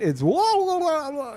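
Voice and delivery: high squealing mimicry of game grunts; mocking hit cries. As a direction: imitate a squeaky hurt yelp. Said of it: "what the voice actress was doing".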